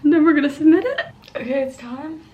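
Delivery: bursting with anticipation; nervous excitement. Real, not acted. Student about to hit submit, excited and loud.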